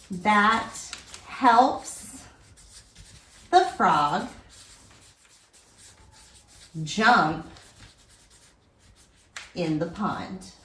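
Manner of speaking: word by word with long gaps; dictating while writing. Real, not acted. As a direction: pace each word to a writing hand. Says sentence while writing it.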